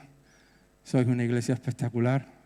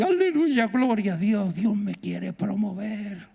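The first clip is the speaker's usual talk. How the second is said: joyful praise; 5 semitones higher than usual. Shown as a demonstration. Preacher modelling what to say in hard times, higher joyful praise. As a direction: burst out Aleluya much higher, then settle.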